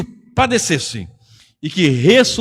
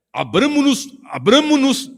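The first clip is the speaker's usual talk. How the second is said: high, showy churchy voice mocking fancy words; mock pompous preacher; 8 semitones higher, 3 dB louder than usual. Pastor mocking pompous preachers to his church, high hammed-up voice. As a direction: ham up a pretentious preacher's call. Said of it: "nem o português fala, aqui é falar bonito".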